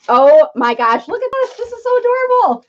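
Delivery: high, gushing squeal of delight. Real, not acted. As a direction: coo over it like a cute puppy. Gushing over pie spatula gift.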